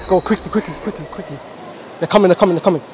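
ordinary delivery

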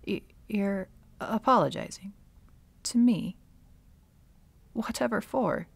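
stammered, rising disbelief; taken aback by an unexpected apology; incredulous, halting questions; baffled surprise. Acted. Villainess startled by an apology.

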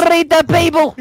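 high, commanding Irish battle cry; excited stage-Irish exclamation; mock Irish. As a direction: order them in a hammed-up Irish brogue. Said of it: "speaking Irish".

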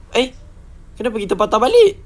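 high, loud, fast question; quick incredulous outburst; baffled disbelief. Real, not acted. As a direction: demand it in rising disbelief. Baffled at looping back.

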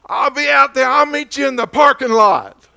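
high, loud mock shout at the other lady; retold angry yell. Pastor imitating the angry Walmart shopper, loud high yell. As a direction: belt it out like a furious shopper. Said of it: "she's still yelling".

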